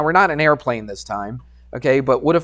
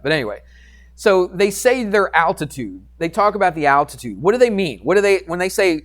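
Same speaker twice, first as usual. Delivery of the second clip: usual speaking voice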